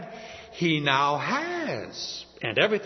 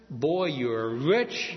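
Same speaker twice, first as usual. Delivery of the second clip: slow, savoured; 3 semitones lower, much slower than usual. Retold or imitated. King's servant announcing fortune.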